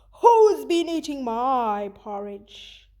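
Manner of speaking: slow, loud bear growl; growling Papa Bear. Acted. Teacher reading Goldilocks to her class, as a growling Papa Bear. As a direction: growl like a big hungry bear. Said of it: "and he growled".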